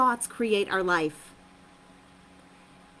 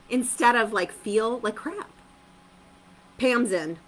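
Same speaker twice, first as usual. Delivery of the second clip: ordinary talk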